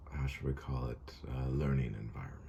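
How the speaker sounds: conspiratorial whispered aside. Real, not acted. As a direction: whisper the phrase as a wry aside. Teacher whispers aside joke.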